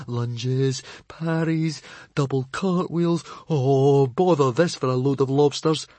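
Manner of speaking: hint of a flustered muttering boy. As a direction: mumble while flipping pages.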